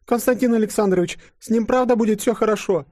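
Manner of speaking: put-on very high, anxious girlish voice; high-pitched fretful question; worried woman. A narrator's reading. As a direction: plead for reassurance in a squeaky voice. Worried Katenka asks the boss.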